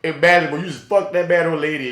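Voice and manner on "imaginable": normal talking voice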